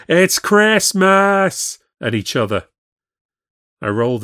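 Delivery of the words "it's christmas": high, loud, long-held festive yell; stretched-out screaming shout; drunken scream. A narrator's reading. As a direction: bellow it out like a drunk impersonating a singer. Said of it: "and were screaming".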